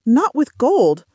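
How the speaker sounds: ordinary delivery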